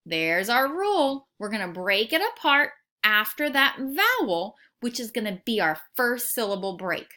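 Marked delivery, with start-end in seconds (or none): none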